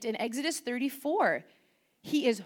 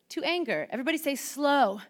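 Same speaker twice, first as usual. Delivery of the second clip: normal talking voice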